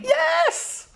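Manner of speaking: high delighted yes; excited cheer. Real, not acted. The reader cheering as Dorothy is freed, high yes. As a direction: cheer the rescue.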